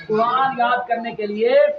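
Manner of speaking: normal talking voice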